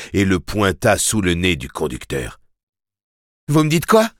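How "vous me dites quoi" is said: high, furious challenge; vociferating. A narrator's reading. Boy pointing a gun at the driver, vociferating. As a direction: shout the question as a threat. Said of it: "vociféra Jake".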